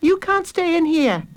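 high, put-on girl's voice; high-voiced big sister; bossy sister. A narrator's reading. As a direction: order the little brother out, pitched high. Bossy sister sends brother away.

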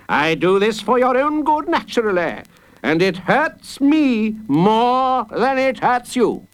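high, drawn-out pompous judge; sanctimonious judge. Acted. Judge justifying the sentence to the boy, self-righteous. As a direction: stretch it smugly in a high voice.

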